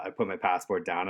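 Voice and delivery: normal talking voice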